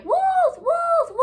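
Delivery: high, loud shouted alarm; crying wolf. Retold or imitated. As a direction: yell wolf for the town. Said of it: "a boy is crying".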